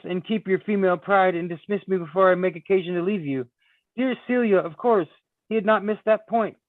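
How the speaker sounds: hint of a proud young woman. Reading Celia's lines aloud.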